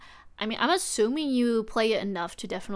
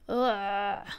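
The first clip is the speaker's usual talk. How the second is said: ordinary delivery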